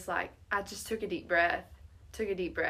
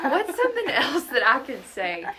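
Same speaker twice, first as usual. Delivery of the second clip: higher, louder, amused replay of panicked thinking; laughing self-mockery; 6 semitones higher, 7 dB louder than usual. Teller mocks her own search for an excuse, laughing and higher. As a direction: laugh through your own desperate question.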